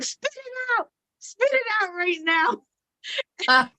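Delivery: high, frantic spit it out; hammed-up alarm, then dragged out slowly; mock panic. Telling her mother-in-law to spit out the bad pie, hammed-up alarm. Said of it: "And I was like".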